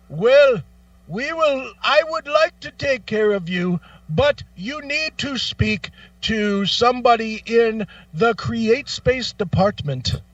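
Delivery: high, slow, scripted politeness; mock polite agent. Agent redirecting caller to another department, high slow mock voice. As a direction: recite the helpline script.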